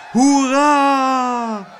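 high, loud, long-held hurrah; whooping crowd cheer. A narrator's reading. People cheering the announced demonstration, whooping. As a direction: whoop it out and hold the last vowel. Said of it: "joelden de mensen".

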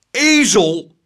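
loud, high, furious insult; outraged explosive shout. A narrator's reading. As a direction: yell it at the culprit. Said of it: "schreeuwde meneer Tump".

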